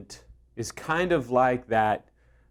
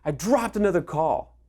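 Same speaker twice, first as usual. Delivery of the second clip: high pitched mock exasperation on damn it; huffing at a dropped call, played light; irritated retold exclamation; mock annoyed outburst; 7 semitones higher than usual. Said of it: "I was pissed".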